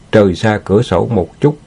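ordinary delivery, nothing marked

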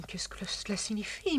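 a lady's shocked whisper; whispered mock countess. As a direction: hush it as the flustered countess. Valet voices countess, whispering.

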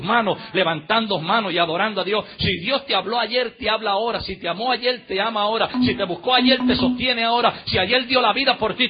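loud rhythmic preaching, swelling line by line; building, forceful preaching. Real, not acted. Preacher assuring congregation God sustains them now, building forceful preaching. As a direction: drive each contrast harder toward the applause.

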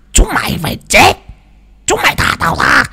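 the spirit demands release, high and shrill-edged; possessed shriek. Acted. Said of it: "mà quát". (giọng vong nhập the thé quát tháo)

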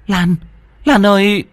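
high, sudden cry of the name; shocked cry. Acted. Dung cries out at the sight of Lan. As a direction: gasp out her name. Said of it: "Chị Dung thốt lên".

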